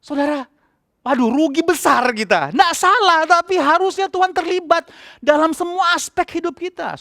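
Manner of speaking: high-pitched fervent preaching. Acted. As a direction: urge the congregation with full conviction. Preaching at full force. (khotbah berapi-api dengan nada tinggi)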